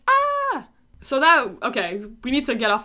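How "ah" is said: panicked scream. Retold or imitated. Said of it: "they're like".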